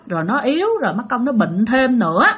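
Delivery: ordinary delivery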